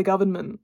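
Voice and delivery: "government" with the N sounded; over-careful "government", N fully voiced; N pronounced in government. Real, not acted. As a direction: say "government" with a clear N before the M. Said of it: "Why did I pronounce the N".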